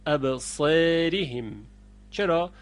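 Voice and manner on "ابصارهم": ابصارهم slowly as absereehim, a bent to e; slow ابصارهم with imala. Shown as a demonstration. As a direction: stretch ابصارهم with e-like vowel. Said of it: "دقت کنید".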